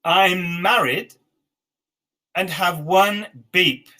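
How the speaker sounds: slow, careful word by word; slow dictation. Shown as a demonstration. Teacher dictating to the class slowly. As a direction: give them time to write. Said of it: "Next one".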